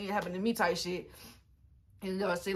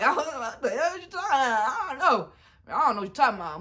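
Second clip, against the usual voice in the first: high, louder protest of innocence; mock innocent denial; 5 semitones higher, 5 dB louder than usual. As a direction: play dumb with a loud high denial. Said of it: "I played dumb as fuck".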